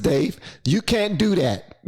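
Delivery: ordinary delivery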